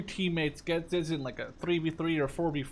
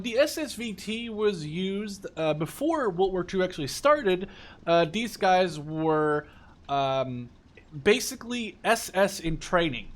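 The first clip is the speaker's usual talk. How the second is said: ordinary talk, nothing marked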